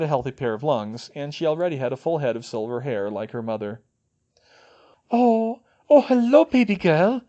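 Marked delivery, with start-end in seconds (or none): 5.1-7.2 breathy. A narrator's reading. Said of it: "Fleur breathed".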